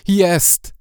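loud, high cry of joy; joyful shout. Acted. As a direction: shout out the find. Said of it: "wykrzyknął uradowany".

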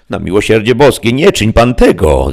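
high, loud pleading cry; alarmed exclamation. A narrator's reading. Sancho begs his master not to strip, loud cry. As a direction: beg him loudly to stop. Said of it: "zawołał Sancho".

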